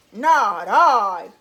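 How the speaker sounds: low, loud gruff voice; gruff it out like a big dog. A narrator's reading. Dog refusing to help the hen, low gruff voice. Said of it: "said the dog".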